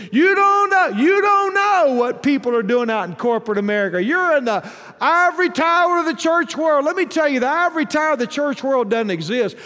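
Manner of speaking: loud, high mock tirade; acted aggrieved protest. Churchgoer protesting to the preacher about being wronged, acted indignant rant. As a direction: pile up the grievances, bursting out on each new one.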